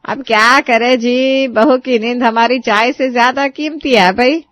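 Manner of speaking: very slow, loud mocking old woman; mother-in-law taunting bride to father-in-law. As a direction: drawl the taunt. Sushila taunts.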